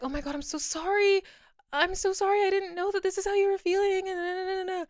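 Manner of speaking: high, gushing sorry from classmates; gushing apology. Retold or imitated. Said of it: "other people were like".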